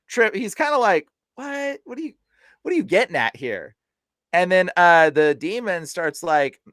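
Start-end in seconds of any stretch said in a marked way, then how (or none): 0.1-3.8 startled. Retold or imitated. Friend's startled reaction retold.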